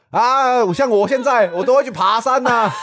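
put-on high, loud, slow know-it-all old man; old man advising about the leather suit, hammed up; imitating the old man. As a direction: lecture like a fussy old uncle. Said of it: "他说".

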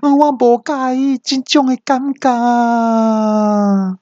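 拔高音调的夸张尖叫; 拖长高音假装抓狂; 模仿大叫. Retold or imitated. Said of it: "那我听到我就大叫说". (对导航机拖长高音假装抓狂)

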